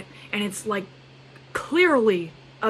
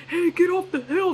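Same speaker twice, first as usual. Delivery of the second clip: high, far-off mock call to clear the slope; a hammed-up ski patrol shout; imitating a ski patroller; 9 semitones higher than usual. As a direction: call out like imagined staff shooing kids. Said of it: "There's no one there like".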